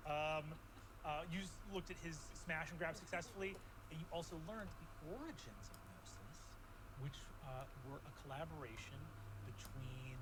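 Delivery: calm storytelling narration; game master recap. Acted. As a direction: set the scene like a game master.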